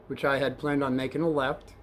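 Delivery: ordinary delivery, nothing marked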